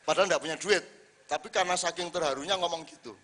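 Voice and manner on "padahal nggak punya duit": ordinary talk, nothing marked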